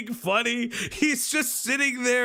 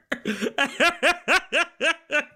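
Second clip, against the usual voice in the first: burst of laughter; 8 semitones higher than usual. Real, not acted. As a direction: open helpless laugh. Laughing at own story.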